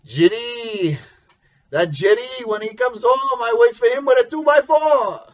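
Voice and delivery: mock-angry French-accented father, pitch climbing high. Father threatening his son over the wrecked car, French accent. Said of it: "with his little French accent".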